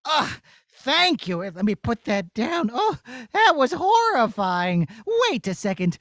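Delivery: usual speaking voice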